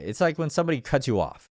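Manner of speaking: usual speaking voice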